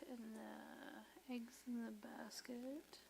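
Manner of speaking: soft unvoiced whisper. Real, not acted. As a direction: whisper the question to yourself. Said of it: "whispering a memory aid".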